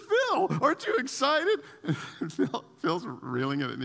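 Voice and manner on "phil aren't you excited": high, eager plea; excited friend. Retold or imitated. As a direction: pester him, thrilled. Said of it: "Jared is going nuts".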